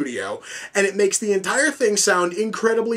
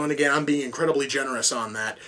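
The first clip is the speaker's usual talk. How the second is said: ordinary talk, nothing marked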